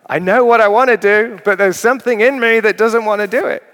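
high, loud, exasperated inner voice; voicing Paul's frustration. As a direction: cry out Paul's struggle with himself. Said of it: "It's like".

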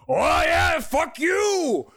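high, hammed-up angry shout; mock fighting yell. Old guy getting into a fight, hammed-up yell. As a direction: shout it like an overacting brawler. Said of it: "every time he gets in a fight".

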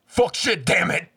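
loud, high string of curses; swearing tirade. Shown as a demonstration. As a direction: belt the curses out for show. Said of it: "I just have a good cussing voice".

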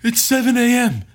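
alarmed. Retold or imitated. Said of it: "she goes".

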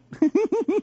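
put-on a high, loud girlish giggle; imitated giggle. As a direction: mimic the wife's delighted giggle. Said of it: "she had the giggle of".

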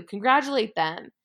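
usual speaking voice

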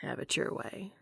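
breathy, near-whispered surrender; resigned whisper. A narrator's reading. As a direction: say it softly, giving in.

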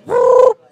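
loud high whistle "whoop"; whistle imitation. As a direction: blast it like a referee whistle.

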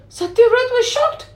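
ordinary delivery, nothing marked

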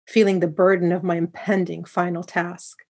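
plain reading voice

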